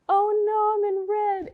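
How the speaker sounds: hammed-up oh no; mock alarm. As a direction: squeal like you just caught your own mistake. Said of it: "and I was like".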